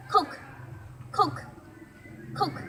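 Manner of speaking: "Cook" with a short clipped oo; vowel demo word. Shown as a demonstration. Child teacher repeats the word for learners. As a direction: drill "cook" for the class.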